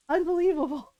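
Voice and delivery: a hammed-up incredulous groan. Ron's mock disbelief, repeated.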